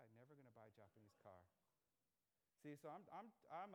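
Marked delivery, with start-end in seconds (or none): 0.0-1.5 dad's line again, much softer and lower; muttering the father's vow under the breath; quiet repeat of dad. Retold or imitated. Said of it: "Anybody have parents who said that?".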